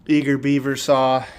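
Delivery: usual speaking voice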